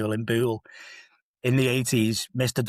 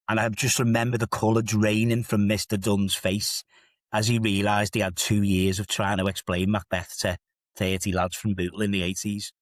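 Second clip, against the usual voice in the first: ordinary talk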